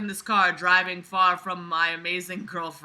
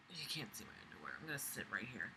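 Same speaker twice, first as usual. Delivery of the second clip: muttered aside; 10 semitones lower, 23 dB quieter, much faster than usual. Real, not acted. Murmured aside.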